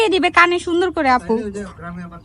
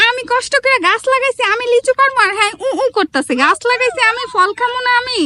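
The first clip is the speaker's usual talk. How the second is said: higher, complaining story character; 8 semitones higher than usual. Acted.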